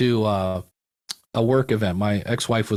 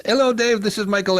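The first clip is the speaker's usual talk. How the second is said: imitating the German listener; 9 semitones higher than usual. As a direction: replay the caller's greeting, pitched up and eager.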